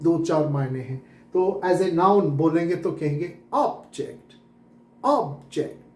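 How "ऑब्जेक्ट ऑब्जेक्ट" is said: Object said OB-ject, long strong 'ob', lighter 'ject'; stress on first syllable. Shown as a demonstration. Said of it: "जेक्ट पे जोर नहीं देंगे". (Object में 'ऑब' पर ज़ोर)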